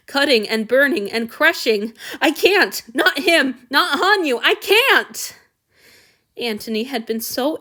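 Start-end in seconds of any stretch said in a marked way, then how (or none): 2.2-5.4 loud, high protest in dread; panicked refusal. A narrator's reading. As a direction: refuse him in a rush of fear.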